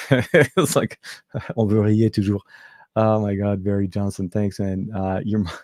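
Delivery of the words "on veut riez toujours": French throat R on "riez"; French accent. Shown as a demonstration. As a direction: say it in French with a uvular R. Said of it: "that French accent".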